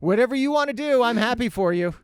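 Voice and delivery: a hint of an excited golden retriever; happy dog. The dog agrees to any trip, in a light, high eager voice. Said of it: "And the dog's like".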